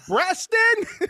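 high, loud barista call across the shop; calling out a name. Retold or imitated. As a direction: call the name out over the counter. Barista calls the name again.

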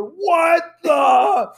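wife shouting from upstairs; imitating a shout. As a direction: cut off the curse mid-yell. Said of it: "I just hear".

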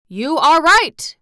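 high, loud, slow declaration; declaring. A narrator's reading. General agreeing with the Gnome King, loud and slow. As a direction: announce it proudly, drawn out. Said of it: "declared the general".

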